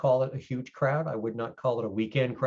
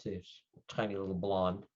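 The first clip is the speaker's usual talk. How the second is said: normal talking voice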